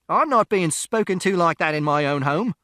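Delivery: high, loud, outraged yell; indignant shout. A narrator's reading. Mister Shears to the intruding father, indignant shout. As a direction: defend your home at full volume. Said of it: "and Mister Shears shouted".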